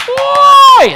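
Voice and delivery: loud, high yell from the stands; crowd yell. Retold or imitated. Preacher imitating a cheering stadium crowd, loud high yell. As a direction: holler like a roused spectator.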